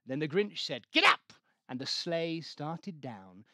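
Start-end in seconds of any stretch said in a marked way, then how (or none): 0.9-1.3 very high, loud command; shout. A narrator's reading. Grinch urging Max to pull the sleigh, loud shout. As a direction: yell it at the dog.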